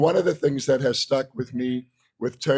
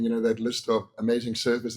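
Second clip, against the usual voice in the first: ordinary delivery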